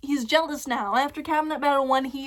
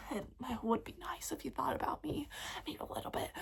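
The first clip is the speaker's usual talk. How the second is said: much softer, a timid whispered plea; 15 dB quieter, much faster than usual. Retold or imitated. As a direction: whisper it as if afraid to ask. Voicing Madison, needy aside.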